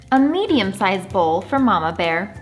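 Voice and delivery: ordinary delivery, nothing marked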